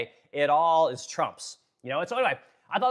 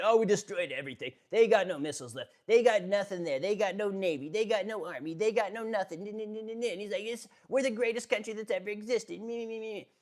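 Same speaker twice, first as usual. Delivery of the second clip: higher, bragging, self-centered mock voice; mocking Trump's boasts, pitched up and petulant; boastful Trump; 6 semitones higher than usual. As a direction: brag like a spoiled child who must win. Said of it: "He keeps saying".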